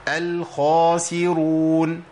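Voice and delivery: الخاسرون with a heavy full خا, lips rounded, slow; slow correct demo of الخاسرون; heavy full kha. Shown as a demonstration. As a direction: say الخاسرون with a thick emphatic kha. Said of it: "لازم أقولها إزاي؟".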